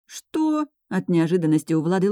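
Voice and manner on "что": startled high-pitched "what?". A narrator's reading. Влада caught off guard by her friend's plan, startled. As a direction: blurt it out high, taken aback.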